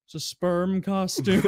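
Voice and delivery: slow, flat-out matter-of-fact answer; deadpan customer. Retold or imitated. Customer on the phone, naming the costume, slow and deadpan. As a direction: drag it out like it's obvious. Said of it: "He's like".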